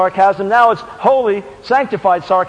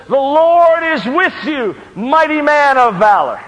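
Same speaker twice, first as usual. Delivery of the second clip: slow, raised heralding call; angel's proclamation; 6 semitones higher, 4 dB louder, slower than usual. Retold or imitated. Angel greeting Gideon in the winepress, slow heralding voice. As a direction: proclaim it with drawn-out weight. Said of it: "angel of the Lord appears to him and says".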